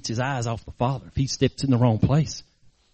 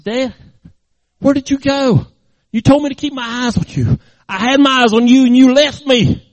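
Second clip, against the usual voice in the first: high, raised accusing plea; hurt reproach; 8 semitones higher, 8 dB louder than usual. Acted. Rescued boy to dad who left him, hurt reproach. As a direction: build to a loud accusation.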